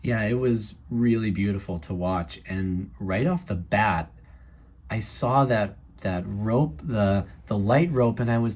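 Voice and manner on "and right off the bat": ordinary talk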